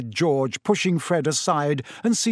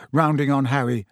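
usual speaking voice

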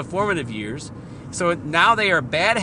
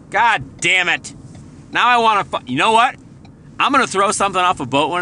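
frustrated outburst; 5 semitones higher, 6 dB louder than usual. Real, not acted.

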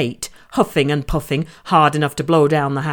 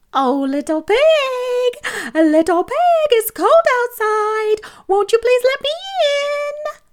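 sweetest voice. A narrator's reading. Wolf coaxes the pig to open the door, falsely sweet. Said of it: "in his sweetest voice".

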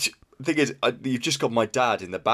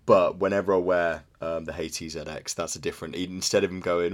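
ordinary talk

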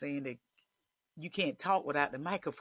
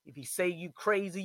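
ordinary delivery

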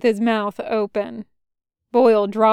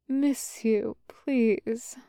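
soft, faint, delirious murmur; weak, softly muttered longing; 6 dB quieter, much slower than usual. A narrator's reading. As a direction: mumble a plea while half-conscious. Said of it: "the general muttered".

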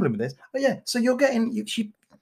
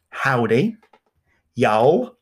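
put-on American accent; 6 semitones lower, much slower than usual. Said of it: "I talk the lingo now".

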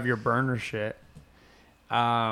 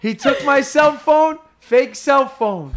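gleeful exclamation; 13 semitones higher, 11 dB louder than usual. Retold or imitated. Imitating mugged comedian telling his boys, gleefully hysterical. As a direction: crow each phrase as a punchline.

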